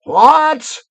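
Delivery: high, loud roar of outrage; roaring witch. A narrator's reading. Witch hears who gave the gifts, roaring. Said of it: "roared the witch".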